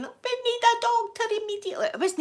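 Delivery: high hammed-up panic plea; mock panicked scream. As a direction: plead for a doctor in fake alarm. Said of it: "I'm screaming".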